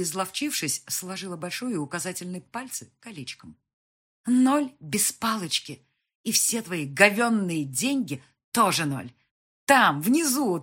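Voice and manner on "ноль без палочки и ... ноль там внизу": loud, drawn-out contempt; scornful anger. A narrator's reading. Mother calling him and his money worthless, scornful. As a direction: spit out the insult with scorn.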